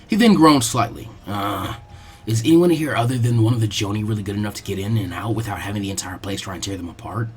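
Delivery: a reluctant genius reasoning it out; low, quiet, unhurried boy; lazy strategist. Acted. Shikamaru thinks it through.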